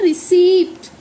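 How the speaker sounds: रिसिप्ट said as ri-SEEPT with audible p; emphatic p-t ending on रिसिप्ट; demonstrating pronunciation. Shown as a demonstration. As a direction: model रिसिप्ट, stressing the second syllable. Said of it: "तो इसको बोलते हैं".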